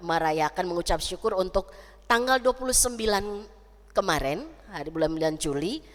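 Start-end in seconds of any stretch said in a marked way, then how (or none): none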